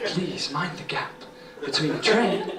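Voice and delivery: soft, slow, seductive announcer voice; tube announcer voice. Retold or imitated. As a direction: purr the announcement like the tube lady. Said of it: "she'll go".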